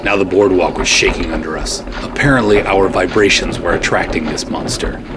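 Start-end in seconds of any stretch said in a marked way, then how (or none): none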